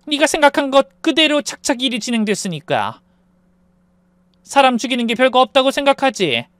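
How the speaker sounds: controlled taunting voice with sudden jabs; menacing mother. A narrator's reading. Mother threatening husband for her daughter's sake, menacing. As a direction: warn him with cool contempt.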